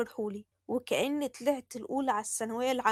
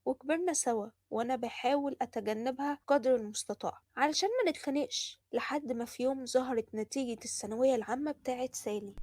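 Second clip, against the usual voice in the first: ordinary delivery, nothing marked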